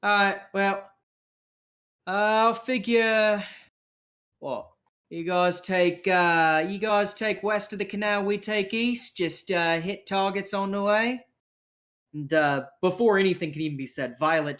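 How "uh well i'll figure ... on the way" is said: higher-pitched rough-and-ready planner; crew member dividing tasks. Acted. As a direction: improvise orders in a cocky character voice. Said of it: "it's like".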